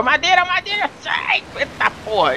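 high frantic shout; panicked yell. Real, not acted. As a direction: yell in alarm. Boss attack incoming, yelling.

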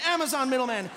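ordinary talk, nothing marked

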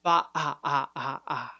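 ba then throat pulsed ah; throat stop drill. Shown as a demonstration. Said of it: "practice that".

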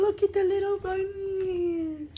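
high, slow sing-song coo. Real, not acted. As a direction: coo over the cute bunnies. Cooing over baby clothes.

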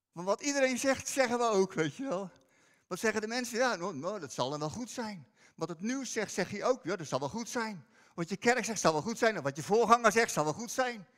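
loud, driving sermon delivery; preaching at full force. Acted. Preacher warning the church against repeating others, loud preaching. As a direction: push the point hard to the congregation.

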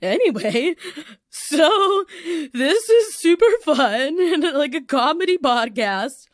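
high, loud put-on brightness through laughter; mock yourself hosting through tears; self-mocking, tearful cheer. Crying host insisting the comedy podcast is fun, self-mocking. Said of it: "at the fact that I'm like".

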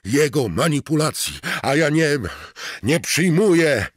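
high, loud, desperate shouting; frantic yell. Acted. Mort shouting down the voice, high and loud. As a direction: yell it out, losing control. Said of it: "wrzasnął".

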